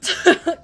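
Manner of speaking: high loud burst of laughter. Real, not acted.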